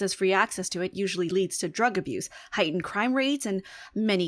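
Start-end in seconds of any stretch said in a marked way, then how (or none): none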